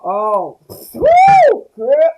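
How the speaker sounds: high, loud excited whoop; loud excited squeal at a comment. Real, not acted. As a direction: let out a big delighted whoop. Reacting loudly to mother's "y'all.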